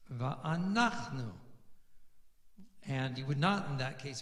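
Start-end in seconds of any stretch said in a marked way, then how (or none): 0.1-1.3 "Va'anachnu" drawn out slowly, stress on NACH; stress on the nun. Shown as a demonstration. Teacher showing where Meteg puts stress, slow "Va'anachnu" stressed on NACH. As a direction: lean on NACH in "Va'anachnu", let NU fall. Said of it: "the stress is on the nun".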